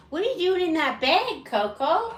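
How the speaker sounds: high, loud sing-song question to a pet; pet talk. Real, not acted. Owner catching Coco in a bag, playful pet talk. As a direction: playfully call out the pet, pitch swooping up.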